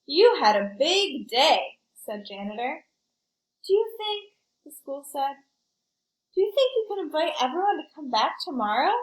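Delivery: put-on lively read-aloud for kids; story narration. A narrator's reading. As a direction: tell it like a picture book to a class. Reads picture book to children.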